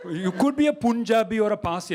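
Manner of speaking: put-on Indian-accented voice. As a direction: imitate an Indian local guessing. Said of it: "when I went to India".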